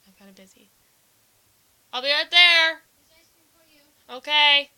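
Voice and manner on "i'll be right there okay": calling out. Real, not acted. Calling out to someone offscreen.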